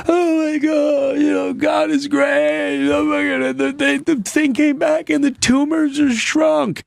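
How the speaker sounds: imitating his wife's overjoyed crying on FaceTime, high and shouted; crying with joy. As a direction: imitate her sobbing out good news. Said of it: "she was crying, like".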